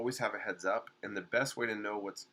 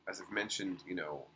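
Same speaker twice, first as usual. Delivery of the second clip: ordinary delivery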